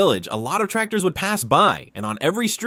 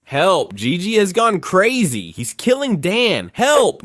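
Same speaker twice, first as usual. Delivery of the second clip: louder, higher alarmed yell; shouting for help; 4 semitones higher, 3 dB louder, slower than usual. Retold or imitated. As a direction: call out for the adults. Cousin Tina shouts for help.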